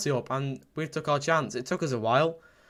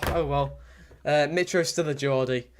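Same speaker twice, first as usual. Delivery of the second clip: usual speaking voice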